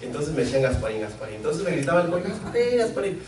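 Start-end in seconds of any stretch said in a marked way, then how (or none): 2.2-3.2 high call of a nickname across the field. Retold or imitated. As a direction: call him like a coach from the sideline. Coach calling nickname from afar.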